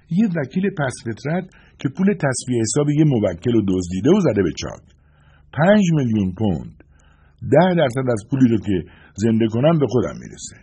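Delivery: high, forceful woman's character voice; Suzie's voice. A narrator's reading. Voicing Suzie explaining her target. (صدای زیر و محکم زن جنگجو)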